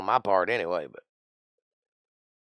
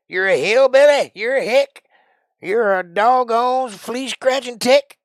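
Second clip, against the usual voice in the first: slow, drawn-out hillbilly jeer; 12 semitones higher, 8 dB louder than usual. Acted. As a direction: holler it like a riled-up country yokel. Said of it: "I'm in a mood".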